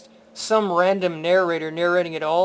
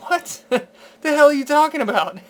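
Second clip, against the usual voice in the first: high, loud disbelieving laugh-out what; mock baffled outburst at her theory; incredulous what; 7 semitones higher, 4 dB louder than usual. Retelling his reaction to her theory.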